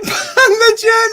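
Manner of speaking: high-pitched laughing delivery; cracking up while saying it. Real, not acted. Laughing at the odd name.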